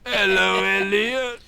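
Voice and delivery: mindless parroted hello; addled old teacher. Acted. Addled old teacher repeats phrase.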